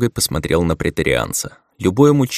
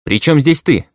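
higher and louder, trying to sound manly; more manly; 8 semitones higher, 5 dB louder than usual. A narrator's reading. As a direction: force a brave tone. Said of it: "повторил уже более мужественно".

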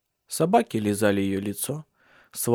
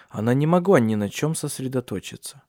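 usual speaking voice

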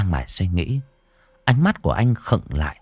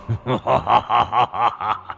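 evil cackle. A narrator's reading. Ghost laughs after dragging her.

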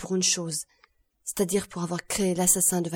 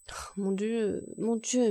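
understated devastated appeal to God; crushed murmur; 3 semitones higher, slower than usual. A narrator's reading. Crushed Villefort reacts to the accusation, murmuring. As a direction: murmur it as if overwhelmed. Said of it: "murmura Villefort anéanti".

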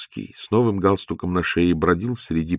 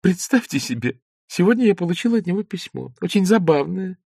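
put-on higher, girlish playful voice; lightly voiced young woman; 11 semitones higher than usual. A narrator's reading. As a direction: Zosya teasing Ostap in a girlish voice. Zosya's voice.

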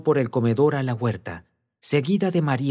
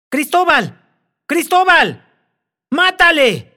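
high, loud, vibrating command; furious shrieking command; terrible hoarse cry; 11 dB louder than usual. A narrator's reading. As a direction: scream the order to kill. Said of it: "Su voz ronca, que vibraba con acento terrible".

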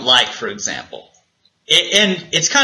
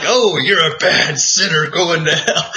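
mocking a preachy condemner, half laughing; mock judgmental believer. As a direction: sneer it like a self-righteous believer. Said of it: "And it's like".

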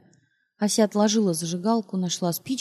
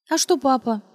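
cheerful; 5 semitones higher, 4 dB louder than usual. Acted. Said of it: "тем же весёлым голосом продолжала Ася".

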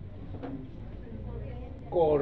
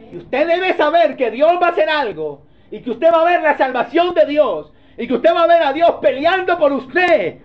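raised, high-pitched sermon delivery; impassioned preaching; 7 semitones higher, 8 dB louder than usual. Real, not acted. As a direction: preach it with full force, pitch held high. Preaches at full force. (predicación enérgica, en tono alto)

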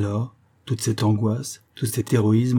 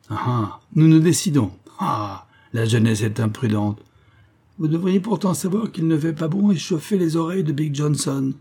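mocking voice; 6 semitones higher than usual. A narrator's reading. The madman taunting the charging James, high and mocking. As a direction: jeer at the reckless youth from above. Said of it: "il dit d'une voix railleuse".

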